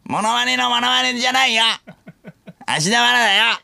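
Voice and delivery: put-on very high, crude child-star caricature; 芦田愛菜. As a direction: squeak it out as a fake little girl. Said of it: "芦田愛菜さんのモノマネのモノマネ？". (芦田愛菜のものまねのものまね、甲高く)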